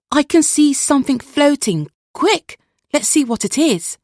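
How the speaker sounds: high, loud call across the beach; villager's shout. Acted. Villager calls to the crowd on the beach, loud and high. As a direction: villager spotting something, calling out to the others. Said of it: "a shout rang out across the beach".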